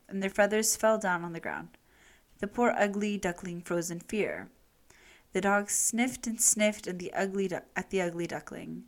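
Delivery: ordinary delivery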